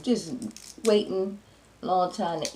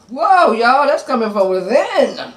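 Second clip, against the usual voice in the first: higher, loud startled exclamation; loud surprised whoa; 6 semitones higher, 12 dB louder, slower than usual. Real, not acted. Eater addressing viewers after a burp, loud and surprised. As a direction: react big to a surprise burp.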